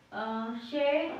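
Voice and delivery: stretched syllable. Shown as a demonstration. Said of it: "इसी में हमने न के आंकड़े को".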